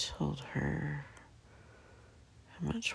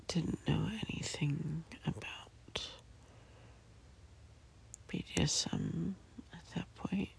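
whispering. Real, not acted. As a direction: whisper it all, barely voiced. Whispers from bed throughout.

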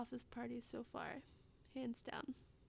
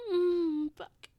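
moans growing louder and higher to a peak; building ecstasy; 4 semitones higher, 17 dB louder than usual. Acted. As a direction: let breathy pleading build into loud cries. Acted scene building to climax.

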